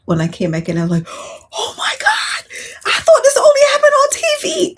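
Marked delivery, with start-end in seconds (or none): 1.1-4.7 high, loud, amazed cry of disbelief. Retold or imitated. Receiving a neighbor's cake, she cries out in amazement. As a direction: shriek it like you can't believe your luck. Retelling own amazed reaction.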